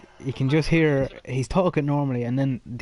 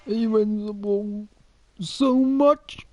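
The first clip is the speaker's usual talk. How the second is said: mock emotional; 8 semitones higher, much slower than usual. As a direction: choke up like the crying fan, pitch climbing higher. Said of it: "you can hear that moment in his voice".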